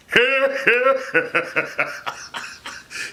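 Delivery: a burst of high laughter; laughing at his own drill. Real, not acted.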